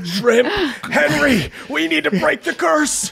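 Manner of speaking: high urgent shadow cry. Acted. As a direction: call out in alarm. Shadow shouting at Henry.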